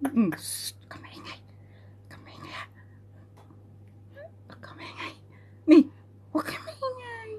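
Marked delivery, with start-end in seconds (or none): 0.4-5.1 hushing whisper. Real, not acted. Parent shushing a noisy baby, whispering. 6.3-7.3 high, very soft hushing coo. Real, not acted. Parent telling baby not to be noisy, softly hushing.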